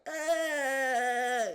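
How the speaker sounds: long, high, loud mock scream; imitated screaming. Woman finding a chipmunk in the shower, screaming. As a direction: scream bloody murder on one held high note. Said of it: "fucking screaming bloody murder".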